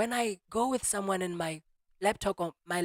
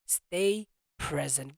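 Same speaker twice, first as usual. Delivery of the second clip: slow emphasis; 2 semitones lower, much slower than usual. Shown as a demonstration. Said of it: "that's the key word".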